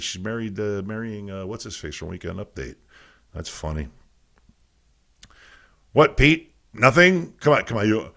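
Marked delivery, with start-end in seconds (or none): none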